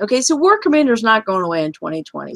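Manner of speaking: ordinary talk, nothing marked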